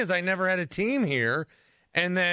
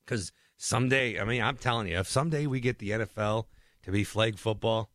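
normal talking voice

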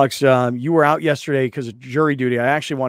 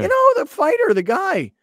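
very high mimicry; insistent colleague; 5 dB louder than usual. Host mimicking colleague explaining the fighter, insistent and high. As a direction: push the explanation in a raised pitch.

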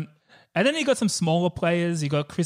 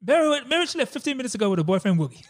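a hint of the stepdad, higher and fast; quoting the cruel stepdad at the door, pitched up quick; mock stepdad; 8 semitones higher, faster than usual. Quoting the stepdad answering the door, lightly voiced.

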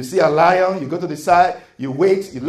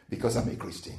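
soft answer; 7 semitones lower, 12 dB quieter, faster than usual. Shown as a demonstration. The right answer, said softly.

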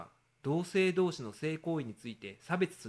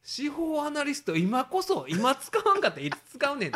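excited inner voice; 11 semitones higher, 6 dB louder than usual. Retold or imitated. Retelling his own thought to his co-host, high and fired up. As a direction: pump yourself up, voice rising.

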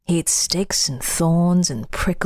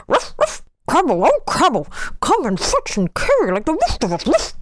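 dog voice; 9 semitones higher, faster than usual. Acted. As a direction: yap it out like a dog. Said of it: "the dog came to him".

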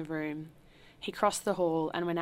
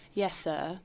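ordinary delivery

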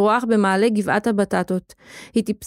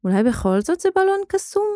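higher, wondering question; a sudden hopeful thought, pitched up; 10 semitones higher than usual. A narrator's reading. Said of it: "ומחשבה מהירה הספיקה לחלוף במוחו". (מחשבה מופתעת ומלאת תקווה)

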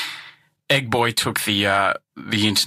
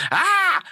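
mock shriek. Old lady shrieks, smacks pasta.

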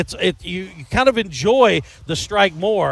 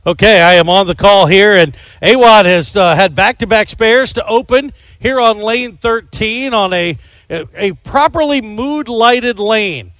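play-by-play; 4 semitones higher, 7 dB louder than usual. Acted. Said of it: "do the play-by-play".

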